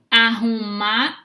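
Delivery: arrumar stretched out very slowly; arrumar, dito bem devagar; slowly drawn out. Shown as a demonstration. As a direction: say arrumar slowly, as if splitting it wrong. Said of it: "por exemplo".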